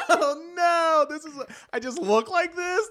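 high, loud, helpless laughter through words; cracking up. Real, not acted. Retelling an awkward reply to the chef, cracking up. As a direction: barely get it out for laughing.

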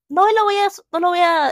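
working herself up about a book she hates, exasperated; exasperated outburst. Real, not acted. Booktuber ranting about poor communication tropes, exasperated and loud.

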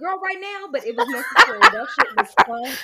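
squealing laughter through words; can't stop laughing; laughing hard. Real, not acted. Friend laughing hard over the funny scene.

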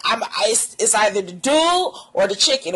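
usual speaking voice

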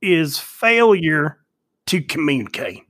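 very slow, drawled movie-quote voice. Retold or imitated. Quoting the Guns N' Roses intro, very slow and drawn out. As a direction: stretch every word like the old speech. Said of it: "the voice at the beginning of the Guns N' Roses song".

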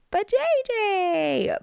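high and slow on vajayjay; high drawn-out vajayjay. Retold or imitated. Recap, dramatic reveal of word.

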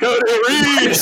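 high loud laughing call-out; teasing shout. Real, not acted. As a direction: yell his name to tease him. Friend calls him out, laughing.

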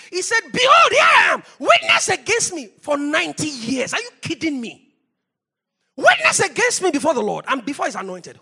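loud, high scriptural challenge; impassioned preaching. Acted. Preacher quoting Samuel's challenge to Israel, shouted and high. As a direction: throw the challenge out at full force.

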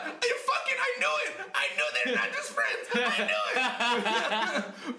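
very high, laughing mock gasp; gossiping fans. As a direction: squeal like fans who caught them. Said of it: "Everyone's gonna be on this".

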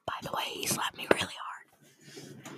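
slow unvoiced whisper. Real, not acted. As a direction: whisper it to the listeners. Whispered aside to audience.

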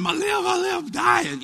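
high wailing cry of a hurt first grader; retold crying wail. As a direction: wail like a little kid with a busted lip. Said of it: "And so I'm crying".

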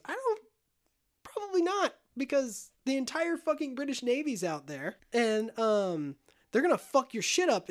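high-pitched hedging, acted out; mock-hesitant high voice; doubtful warning. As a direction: pitch it way up, as if gently talking someone out of it. Jack warns Barbossa off, acted.

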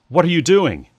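high, loud shocked question; crying out. A narrator's reading. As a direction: cry it out in alarmed protest. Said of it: "Clarissa cried out".

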